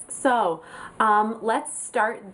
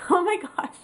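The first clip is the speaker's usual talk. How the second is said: laughing; 10 semitones higher than usual. Real, not acted. Laughing at the name mix-up.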